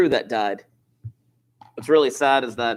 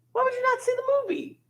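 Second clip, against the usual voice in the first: mock disbelief; 7 dB quieter, faster than usual. Mock disbelief that students skipped movie.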